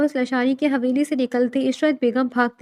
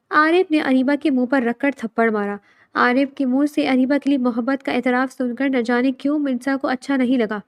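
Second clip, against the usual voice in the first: ordinary delivery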